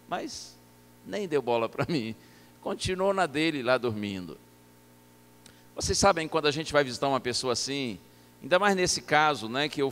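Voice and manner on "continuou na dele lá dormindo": ordinary delivery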